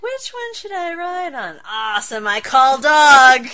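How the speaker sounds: high, eager, then a loud drawn-out claim; mock eager call. Lightning Lad calling dibs on riding the dog, hammed-up eager. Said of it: "He's like".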